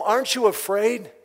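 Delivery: loud, high exasperated yell; retold soldier yell. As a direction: bark the question at the noisy kid. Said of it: "he yells at the boy".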